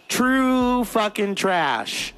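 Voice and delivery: high, very drawn-out scorn; emphatic contempt. Real, not acted. Comic passing judgement on old classmates, drawn-out contempt. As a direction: stretch every word in disgust. Comic hammering home the insult.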